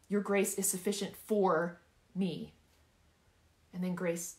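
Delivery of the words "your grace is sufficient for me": slow, with "for" punched and lengthened; emphatic, stretched "for"; stress on FOR. Shown as a demonstration. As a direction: land hard on "for", pause before the last word. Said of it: "I want you to emphasize each word".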